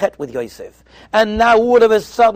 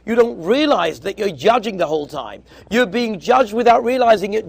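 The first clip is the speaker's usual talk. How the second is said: ordinary delivery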